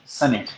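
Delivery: सनेट as "sun-it", short first vowel; corrected pronunciation. Shown as a demonstration. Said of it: "pronunciations भी correct करते रहेंगे".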